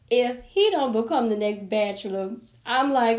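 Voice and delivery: ordinary delivery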